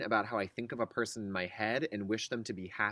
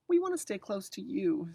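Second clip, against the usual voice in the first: put-on higher small child's plea; lightly voiced child; 8 semitones higher than usual. Scared twin begging mom to stay close, childlike. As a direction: plead like a scared little kid. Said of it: "We're scared".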